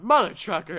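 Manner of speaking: high, loud outburst; yelled curse. Retold or imitated. Yelling out in class at the spoiler, high and loud. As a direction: yell it out in class. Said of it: "And I yelled out".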